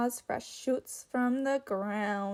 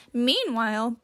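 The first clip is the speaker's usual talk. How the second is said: ordinary delivery, nothing marked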